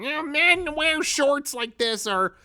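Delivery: squeaky, drawn-out mockery of the critics; mocking impression. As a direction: parody the hypocrites in a squeaky sneer. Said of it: "turn around and go like".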